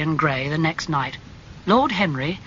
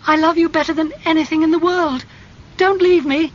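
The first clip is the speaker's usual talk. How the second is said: loud, very high, frantic pleading; desperate cry; 12 semitones higher, 6 dB louder than usual. A narrator's reading. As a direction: cry out and beg him to stay. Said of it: "she cried".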